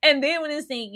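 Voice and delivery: normal talking voice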